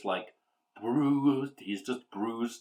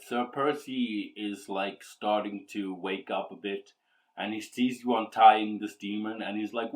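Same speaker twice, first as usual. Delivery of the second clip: ordinary talk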